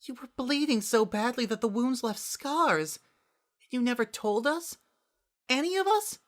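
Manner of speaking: high, shaky, rising into upset; wavering distress. A narrator's reading. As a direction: voice breaking with worry. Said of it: "voice wavering".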